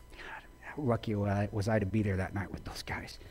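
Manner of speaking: soft, breathy, unvoiced confession; whispered aside. Real, not acted. Remembering his team that night, a hushed whisper. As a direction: tell it to yourself in a whisper.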